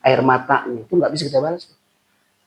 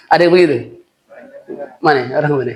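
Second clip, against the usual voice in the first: amused laugh at the congregation; 2 semitones higher, 5 dB louder, much faster than usual. Real, not acted. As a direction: chuckle through the question. Preacher laughs at his own joke.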